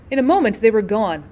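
usual speaking voice

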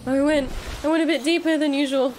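excited. Real, not acted. Thrilled after a deep dive.

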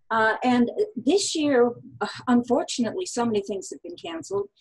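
ordinary talk

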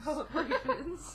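slow, laughing Garrus impression; drawn out through laughter. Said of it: "as Garrus Vakarian".

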